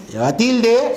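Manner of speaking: usual speaking voice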